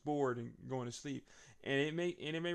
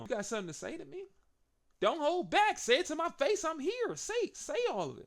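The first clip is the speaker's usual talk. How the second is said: Seth impression; 14 semitones higher than usual. Seth daring Roman face to face, higher hammed-up voice. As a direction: goad him in a raised squeaky voice. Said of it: "He says".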